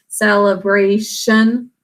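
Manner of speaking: "celebration" with muffled second vowel, SEL-uh-BRAY-shun; everyday pronunciation. Shown as a demonstration. Teacher showing how we really pronounce it, "celebration" with muffled uh. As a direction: say "celebration" with an unstressed uh after sel. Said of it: "because we really pronounce".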